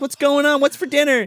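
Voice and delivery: put-on squeaky little-girl voice, high up; bright high kid greeting. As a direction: chirp it like a cheerful kid coming home. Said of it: "It's like".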